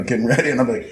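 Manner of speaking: ordinary talk, nothing marked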